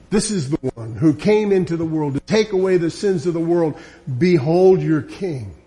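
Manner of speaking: ordinary talk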